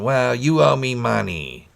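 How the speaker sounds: high, slow waitress imitation; diner waitress voice. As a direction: drawl it like a tired fifties diner waitress. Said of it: "sound like a nineteen fifties diner waitress".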